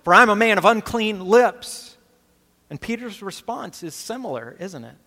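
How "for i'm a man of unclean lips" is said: cried out. Acted. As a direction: cry it out like a ruined prophet. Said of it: "he cried out".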